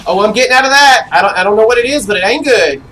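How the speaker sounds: loud, fast, alarmed retreat. Real, not acted. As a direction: bail out in a panic.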